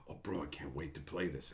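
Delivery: gasping hushed anticipation; awed gasp. Retold or imitated. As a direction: breathe it out like it's too good to be true.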